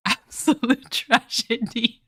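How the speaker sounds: slow, high laugh-through words; breaking up laughing. Real, not acted. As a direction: laugh out the mock lament. Laughing at own phrasing.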